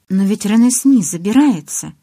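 ordinary delivery, nothing marked

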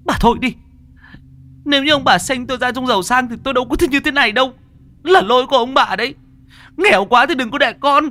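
high, loud, fast furious shriek; shrieking back. Acted. Daughter shrieking furiously at her own parents. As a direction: scream the blame at her parents. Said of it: "con Lải rít lên".

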